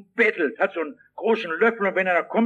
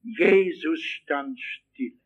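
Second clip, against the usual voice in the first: very slow; 3 semitones lower, much slower than usual. Real, not acted. Preacher savours key verse.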